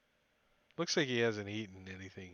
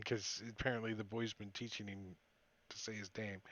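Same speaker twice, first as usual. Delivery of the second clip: ordinary delivery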